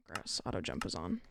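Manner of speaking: ordinary talk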